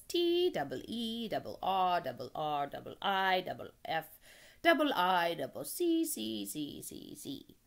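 loud, honking letter-by-letter chant; gander spelling. Acted. Gander spells with stuttering repeats.

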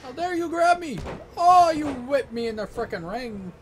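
very high, loud indignant shouts; outraged yelling. Real, not acted. Player yelling at opponent who grabbed him, outraged shout. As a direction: bellow it like you were wronged.

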